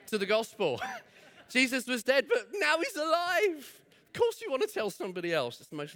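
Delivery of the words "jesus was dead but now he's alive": excited. Acted. Preacher echoing joyful news.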